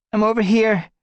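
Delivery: high, loud reply; answering call. Acted. Leafpaw answering her sister, loud reply. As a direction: call back so she finds you.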